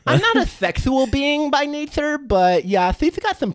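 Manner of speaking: very high, cheery squeak; squeaky fish creature. Acted. Fish creature greeting its bro friend, very high squeaky voice. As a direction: greet your buddy in a tiny high voice.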